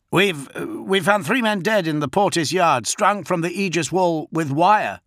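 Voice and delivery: a strained officer calling in bad news on the radio; nervous captain on a crackling vox; soldier reporting over vox.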